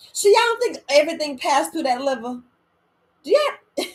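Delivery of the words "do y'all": teasing challenge. Real, not acted. Challenging the audience.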